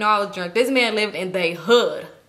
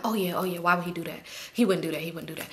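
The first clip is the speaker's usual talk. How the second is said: soft, low conceding repetition; acted self-reassurance; 4 semitones lower, 8 dB quieter, faster than usual. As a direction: talk yourself down under your breath. Retold talking herself out of fear.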